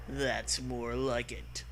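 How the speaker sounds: ordinary talk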